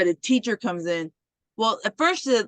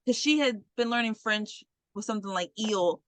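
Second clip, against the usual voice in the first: ordinary delivery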